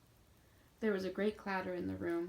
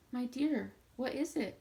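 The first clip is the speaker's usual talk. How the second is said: high, louder, anxious concern; concerned question; 5 semitones higher, 5 dB louder than usual. A narrator's reading. Mrs Bhaer anxiously asking the crying boy, high and concerned. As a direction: rush to the sobbing boy with worry. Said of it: "asked Missus Bayer".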